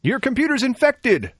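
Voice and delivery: high, loud panicked warning; mock alarm. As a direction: shout it like a scary popup.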